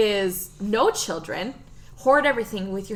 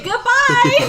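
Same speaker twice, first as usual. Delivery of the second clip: high, loud comic goodbye; gleeful mock farewell; 13 semitones higher, 7 dB louder than usual. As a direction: call it out gleefully, far too high. Acting out balloon send-off.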